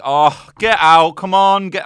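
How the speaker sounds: loud jeering shout, drawn out; crowd yelling. Retold or imitated. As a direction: yell him off the stage. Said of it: "And they're like".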